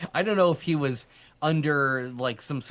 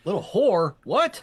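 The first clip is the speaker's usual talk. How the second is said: loud shocked disbelief, higher; mock shocked; 7 semitones higher, 6 dB louder than usual. Real, not acted. Co-host gasps at a misheard word, mock shocked. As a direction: pretend to be scandalized by the mishearing.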